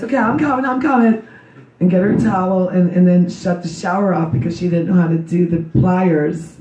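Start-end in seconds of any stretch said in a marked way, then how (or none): 0.0-1.2 higher, hurried calling back; hurrying reply. Retold or imitated. Mother hurrying upstairs to her daughter, calling higher. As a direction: shout it while running up the stairs. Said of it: "run upstairs".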